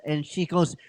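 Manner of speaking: usual speaking voice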